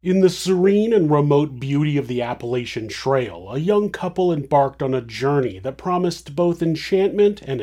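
hushed, ominous true-crime narration; suspenseful crime show narrator. A narrator's reading. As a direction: draw listeners into the dread. Dark cold-open narration.

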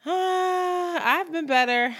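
long, high sighing drawn-out answer; weary groan. Real, not acted. Co-host answering how are you after a bad workday, groaning. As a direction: groan out how rough it has been.

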